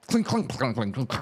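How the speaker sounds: rapid run of clinks, starting high; clattering metal. Acted. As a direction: imitate metal clattering out of control. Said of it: "Then you hear a".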